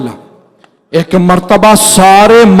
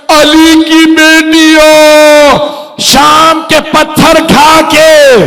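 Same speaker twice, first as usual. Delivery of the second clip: preaching at full force; 6 semitones higher, slower than usual. Acted. Impassioned high wail.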